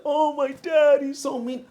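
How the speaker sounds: high, mocking whine about a mean dad; whiny complainer. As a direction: sneer it like a spoiled teen whining about dad. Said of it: "And some people like".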